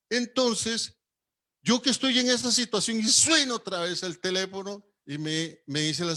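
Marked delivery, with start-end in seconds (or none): none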